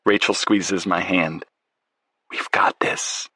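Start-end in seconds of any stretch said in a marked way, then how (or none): 2.3-3.3 hushed whisper, breath only; a tender whispered promise to her husband. A narrator's reading. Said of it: "Rachel whispers".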